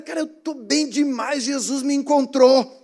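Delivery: high, loud, slow joyful declaration; loud excited testimony. Retold or imitated. Claudinho telling everyone Jesus found him, loud and joyful. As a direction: announce it to the whole bus. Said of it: "ele falou tão alto".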